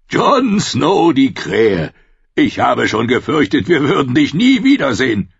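loud, hearty big-man voice; boisterous Tormund, mocking and glad; booming wildling chief. A narrator's reading. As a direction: greet him like an old rough friend.

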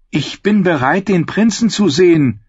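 proclamation. A narrator's reading. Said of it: "verkündete sie Timot".

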